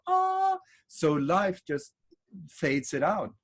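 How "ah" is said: high squealing "Ah!" of thrill; excited gasp. Retold or imitated. New car owner squeals with joy, high excited gasp. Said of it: "Every time you get in".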